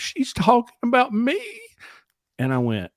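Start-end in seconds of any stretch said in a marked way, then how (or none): 0.0-1.4 high mock-tearful wail; playing her sobbing reply, voice up high; imitating tearful girlfriend. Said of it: "and she's crying".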